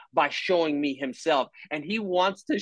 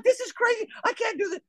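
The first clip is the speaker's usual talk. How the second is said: high, frantic mom voice; overwhelmed mom; faster than usual. Retold or imitated. Busy mom with crying baby and soccer practice, high frantic squeal. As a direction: squeal it, at the end of her rope. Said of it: "And she's like".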